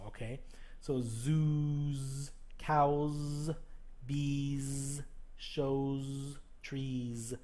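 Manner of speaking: "zoos" slow with a long buzzed z; voiced z endings held; exaggerated z endings. Shown as a demonstration. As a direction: stretch the z in "zoos" and "trees". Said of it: "it has the Z sound".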